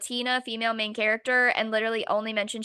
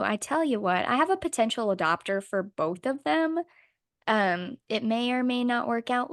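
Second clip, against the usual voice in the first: normal talking voice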